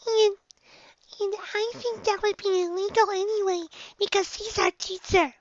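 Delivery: high, slow timid filly; cautious young filly. A narrator's reading. Dinky hesitant filly.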